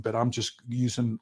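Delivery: ordinary talk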